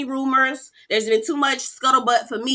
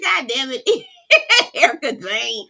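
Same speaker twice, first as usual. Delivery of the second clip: cracking up while saying her name; real laughter through the words; laughing; 4 semitones higher, 4 dB louder, slower than usual. Real, not acted. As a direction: laugh it out, slowly. Laughing at EJ's comment.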